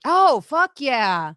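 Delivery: slow, loud, stretched agreement; drawn-out fuck yeah. Real, not acted. Agreeing loudly with the co-host, drawn out slow. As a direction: drag it out big and loud.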